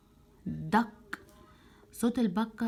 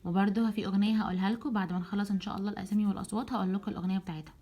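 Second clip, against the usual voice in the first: ordinary talk, nothing marked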